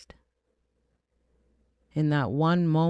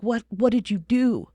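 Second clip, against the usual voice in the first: high, stammered self-accusation; reenacted appalled outcry; horrified disbelief; 7 semitones higher, 4 dB louder than usual. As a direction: demand it of yourself, pitched up. Reenacting horrified questioning of herself.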